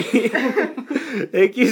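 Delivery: laughing thanks. Real, not acted. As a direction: thank them while still giggling. Host still laughing.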